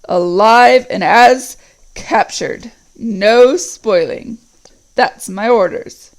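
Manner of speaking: very slow, loud orc captain; deep voice. Acted. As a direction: back up the order, heavy and slow. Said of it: "said the deep voice".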